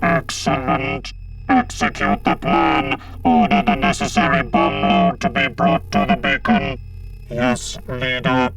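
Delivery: slow, loud electronic monotone; buzzing synthetic voice; robotic Cyberman commander. A narrator's reading. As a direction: issue orders in a mechanical drone. Cyber Leader orders, subordinate answers.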